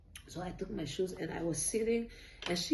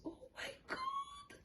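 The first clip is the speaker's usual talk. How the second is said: squealed way up high, slow and soft; a delighted little squeal; very happy; 12 dB quieter than usual. Retold or imitated. Friend Gloria thrilled at the ultrasound, high happy squeal. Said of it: "like, very happy".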